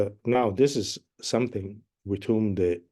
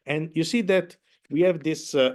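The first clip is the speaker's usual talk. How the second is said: usual speaking voice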